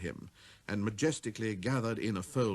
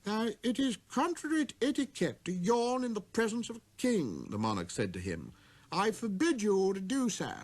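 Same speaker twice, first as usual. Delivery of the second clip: high, stern royal voice; the king; 9 semitones higher than usual. Acted. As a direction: scold him for breaking etiquette.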